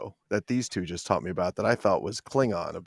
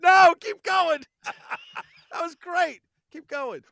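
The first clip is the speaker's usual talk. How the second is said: laughing; slower than usual. Real, not acted. Laughing through instructions.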